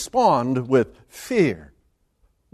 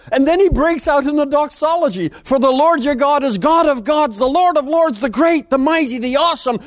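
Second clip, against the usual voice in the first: much higher and louder, ringing praise; preaching at full force; 12 semitones higher, 4 dB louder than usual. Acted. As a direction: lift the whole room with it. Preacher builds into doxology.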